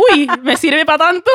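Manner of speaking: high, loud mock amazement; mock surprise. Speaker jokes to her friend about her diploma, with high, loud mock surprise. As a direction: act amazed the cheap diploma is actually useful.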